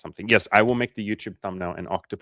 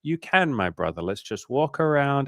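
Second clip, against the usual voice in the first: coaxing friend; 6 semitones higher than usual. Retold or imitated. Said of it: "I was like".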